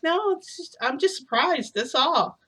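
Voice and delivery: high-pitched covering up; retold awkward reassurance, pitched high; acted flustered denial. Caught out, covering her reaction.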